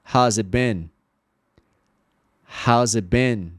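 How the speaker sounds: "it" without T flowing into "been"; connected model with silent T in "it"; no T, linked. Shown as a demonstration. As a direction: say "it been" smoothly, T dropped. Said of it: "sem a letra T".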